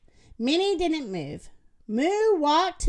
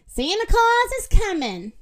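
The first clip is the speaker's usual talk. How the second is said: high, raised-voice proclamation; yelled announcement; 8 semitones higher, 4 dB louder than usual. A narrator's reading. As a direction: call it out for all to hear. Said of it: "Minnie yelled".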